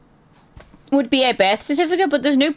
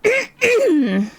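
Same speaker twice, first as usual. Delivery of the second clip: voice cracking; 10 semitones higher than usual. Real, not acted. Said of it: "Why is my voice leaving my body".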